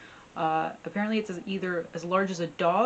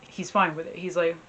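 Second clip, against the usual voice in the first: usual speaking voice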